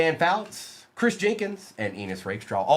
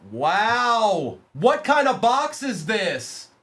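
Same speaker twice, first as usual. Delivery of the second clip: surprised; 6 semitones higher, much slower than usual. Real, not acted. Surprise at a rare card box.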